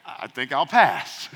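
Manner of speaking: slow, dismissive decline; a lazy "I'll pass"; mock refusal. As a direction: let it drop lazily.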